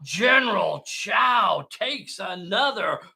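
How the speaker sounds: slow, loud, stretched-out play-by-play; dramatic announcer. Acted. Game host calling the general's hit, dramatic announcer. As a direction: build to a big shouted final word.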